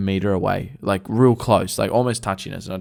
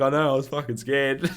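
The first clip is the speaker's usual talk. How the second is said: laughing confession; 3 semitones higher than usual. Retold or imitated. Retold confession, told through laughter.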